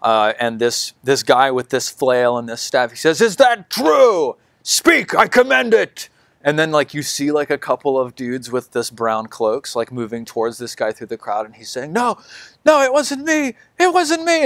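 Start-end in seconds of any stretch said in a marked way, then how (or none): 3.2-6.1 very high, slow shouted zealot; fanatic's demand. Acted. Fanatic demands answers from the crowd, shouting high. 11.9-14.5 very high, frantic pleading; panicked denial. Acted. Accused man pleads his innocence, very high and frantic.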